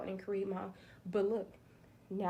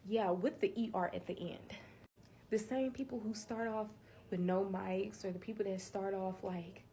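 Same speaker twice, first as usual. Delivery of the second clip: normal talking voice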